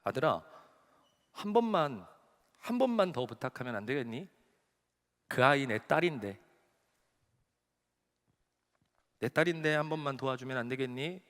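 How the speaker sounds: low, soft, halting entreaty; gentle tearful plea. Retold or imitated. God begging his son for one favour, understated tearful plea. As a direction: plead softly with long pauses, imitating a grieving father. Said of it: "우시면서 이 목사님께 이렇게 얘기하셨대요".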